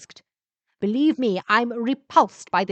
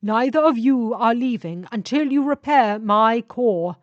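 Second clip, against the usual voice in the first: slow, louder menace from the trapped ship; threatening ship spirit; 3 semitones higher, 7 dB louder, slower than usual. Acted. Ship spirit traps the travellers, threatening. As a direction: threaten them coldly.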